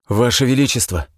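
breathless cry of a herald; gasping, urgent call; urgent panting report. A narrator's reading. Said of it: "захлёбываясь дыханием".

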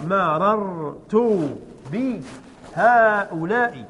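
مررت بهؤلاء stretched very slowly, syllable by syllable; drawn-out example. Shown as a demonstration. Teacher to pupils, dictating the sentence very slowly. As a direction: spell the example out slowly for the class. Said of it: "teacher drawing out example sentence".

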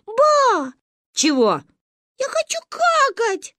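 put-on a small child with an urgent need; whiny little girl. Gayane tells Ba she needs the toilet: high toddler whine.

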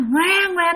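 high-pitched mock newborn wail; a light imitation of a baby's cry; imitated baby crying. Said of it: "ba nghe tiếng nít khóc".

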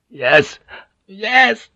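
strained yes, leaping high; high, agonised gasp of surrender; gasping through agony. A narrator's reading. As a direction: give in, crying out in pain. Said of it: "Blackthorn gasped through his agony".

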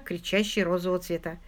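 plain reading voice

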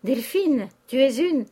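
loud, high-pitched threat of an insult; angry outburst. A narrator's reading. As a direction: cry her name, then start the insult. Countess's insult, cut off in quarrel.

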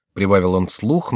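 plain reading voice

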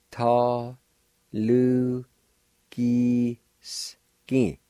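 Ta, lu, ki, s, ki said one syllable at a time; syllable demo. Shown as a demonstration. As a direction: spell it out in sounds for the class. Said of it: "First part is pronounced like this".

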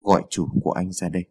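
low, quick, cold command; cold and cutting. A narrator's reading. Woman demands the servant fetch his boss, coldly cutting. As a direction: order him coolly, without delay. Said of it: "giọng sắc lạnh lại vang lên".

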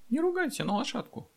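put-on very high-pitched child's voice; tiny high voice, mildly indignant; indignant little girl. A narrator's reading. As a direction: protest like an offended small child. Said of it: "возмутилась Настёна".

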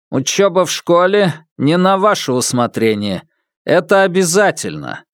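a hint of a stern robot teacher; lightly voiced machine voice, firm; metallic robot voice. A narrator's reading. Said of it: "звучал металлический голос Муаллима".

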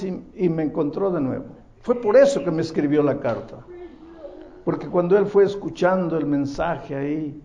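rising pulpit fervor; impassioned preaching. Acted. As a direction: drive the testimony home to the congregation. Preaching climax of testimony.

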